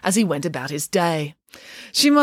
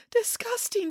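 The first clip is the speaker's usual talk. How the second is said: high, soft, tearful repeat; sobbing echo; 13 semitones higher, 8 dB quieter than usual. A narrator's reading. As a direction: echo it hurt, with a sob. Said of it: "said Echo with a sob".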